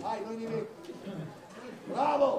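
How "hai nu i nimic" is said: much softer, lowered reassurance; consoling. Real, not acted. Coach consoling a player who did not shoot, soft consoling. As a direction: let it drop, soft and low.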